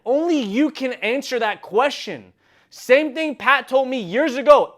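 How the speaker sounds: loud, high-pitched rallying cry; full-force motivational preaching. Real, not acted. Urging strugglers to answer how bad they want it, full-force preaching. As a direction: drive each line home at a shout, stretching key words.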